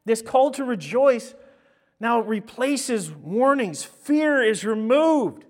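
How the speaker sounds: booming, slow preacher proclaiming love; full-force preaching. Acted. Pastor booming scripture to the congregation, full-force preaching. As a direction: preach it out loud and high to the whole church. Preacher declares rejoicing replaces warnings.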